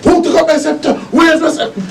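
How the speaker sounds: high, frantic, fearful calling; frightened pharaoh voice. Retold or imitated. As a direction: keep the in-character panic going. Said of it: "I'm speaking with a fear of voice".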